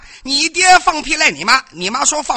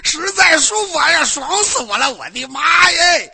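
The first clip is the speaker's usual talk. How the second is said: hammed-up bliss; 5 semitones higher than usual. Mock ecstasy on stage.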